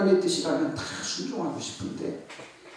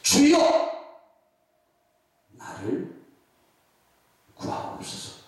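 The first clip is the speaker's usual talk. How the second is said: crying out in prayer; slower than usual. Shown as a demonstration. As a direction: cry out, then sink into pleading. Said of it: "이렇게 하면 돼요".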